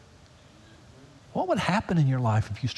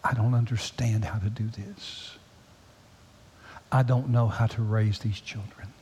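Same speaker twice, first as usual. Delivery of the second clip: tearful prayer; 4 semitones lower than usual. Retold or imitated. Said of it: "in tears going".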